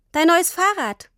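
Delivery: put-on high, beaming child's announcement. A narrator's reading. Tini presents Papa with his new bike, beaming. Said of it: "sagte Tini strahlend".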